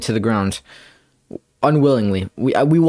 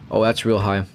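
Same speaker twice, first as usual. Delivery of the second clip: ordinary delivery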